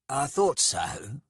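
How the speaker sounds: measured, knowing response; cool. A narrator's reading. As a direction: answer coolly, drawing it out. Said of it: "said Skalg coolly".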